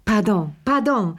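high, fussy apology; excited old lady. A narrator's reading. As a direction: flutter out a quick pardon.

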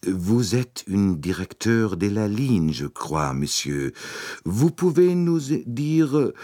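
put-on slow, deliberate English-accented French; careful French. A narrator's reading. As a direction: spell out each French word carefully. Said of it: "in sorgfältig gedrechseltem Französisch".

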